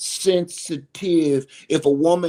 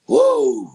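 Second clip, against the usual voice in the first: whooping exclamation; 9 semitones higher, 7 dB louder than usual. Real, not acted. Exclaims at his realization.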